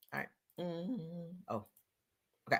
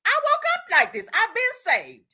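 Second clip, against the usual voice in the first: mock Peter; 7 semitones higher than usual. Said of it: "That's what Peter said".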